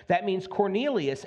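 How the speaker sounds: usual speaking voice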